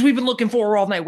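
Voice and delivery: fast, high, loud and eager; imitating an urgent caller. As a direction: rush it out like a man on the hunt. Eager tabloid man on phone.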